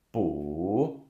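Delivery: drawn-out "bu" dipping, then rising; third tone, dipping. Shown as a demonstration. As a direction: take "bu" down and back up. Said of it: "all the four tones with bu".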